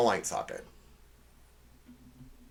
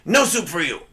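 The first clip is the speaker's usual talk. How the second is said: higher-pitched mock Soup Nazi accent; 4 semitones higher, 4 dB louder than usual. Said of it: "you had to say it in that accent".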